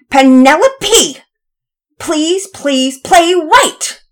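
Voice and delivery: high, loud exasperated plea; impatient boy yelling a drawn-out demand; beseeching, not sweetly. Acted. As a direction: beg your sister at the end of your patience. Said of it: "beseeched his sister in a way that was not very sweet".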